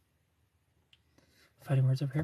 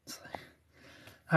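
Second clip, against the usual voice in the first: whisper; 14 dB quieter than usual. Real, not acted. Reading comments, drops to whisper.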